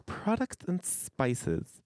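high, slow drawl on Products; twangy Products. Shown as a demonstration. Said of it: "instead of".

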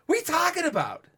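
very high incredulous what; mock disbelief. Family reacting to mom, mock disbelief. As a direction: squeal in disbelief. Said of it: "we're like".

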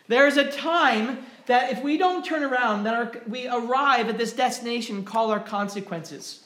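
loud, high impassioned preaching; full-force preaching. Acted. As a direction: drive the warning home to the congregation.

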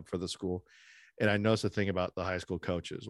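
usual speaking voice

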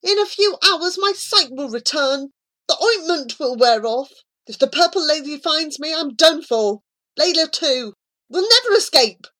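put-on a frightened boy losing hope; despairing boy. Abu fearing the Purple Lady, loud and panicked.

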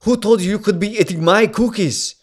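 put-on high, loud Austrian-accented outrage. Husband outraged over his cookies, shouted Austrian accent. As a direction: explode indignantly in an Austrian accent. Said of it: "I have to do this motherfucking accent".

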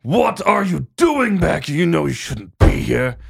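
loud, slow, high angry confrontation; furious brother confronting the returned man; angry shout. Acted. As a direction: grab him and bark it in his face. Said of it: "It's like".

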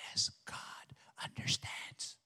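soft tempting whisper in the ear; whisper into your ear. Acted. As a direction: breathe it like a tempter. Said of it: "the enemy will whisper into your ear".

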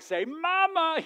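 very high, drawn-out mock mom voice; slow, high baby-talk coaxing. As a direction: imitate a mother begging for baby's first word. Wife competing to coax baby.

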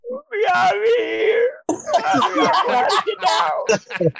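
put-on high, slow, crying little-boy voice with a laugh; dad mock-imitating his trapped little son crying; imitating crying child. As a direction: mimic a scared toddler sobbing in a locker. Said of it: "he's kinda crying".

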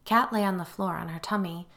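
ordinary delivery

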